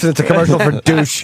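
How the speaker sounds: ordinary talk, nothing marked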